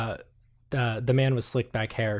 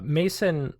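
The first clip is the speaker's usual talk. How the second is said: ordinary delivery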